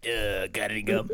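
low, rough ghost voice; poltergeist voice. Pretend. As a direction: growl the question low. Repeats the ghost voice.

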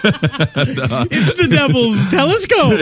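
high, slow, laughing mock warning; laughing parody of a superstitious mom; mock horror. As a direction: ham up a fearful mom through laughter.

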